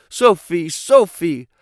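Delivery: acted scream, short of full force. A narrator's reading. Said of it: "and screaming out".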